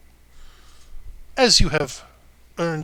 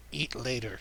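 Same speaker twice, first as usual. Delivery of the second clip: barbarian voice; 3 semitones lower, 5 dB quieter than usual. Acted. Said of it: "stupid barbarian voice with animals".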